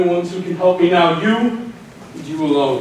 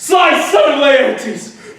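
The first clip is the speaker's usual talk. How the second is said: high, slow, loud cry of hatred; furious curse; 8 semitones higher, 4 dB louder, slower than usual. Acted. Ajax curses Odysseus, his hated enemy, with a slow furious shout. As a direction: bellow the enemy's name in rage.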